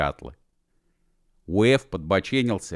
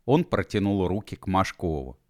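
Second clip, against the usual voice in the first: plain reading voice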